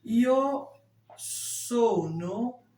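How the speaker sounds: ordinary talk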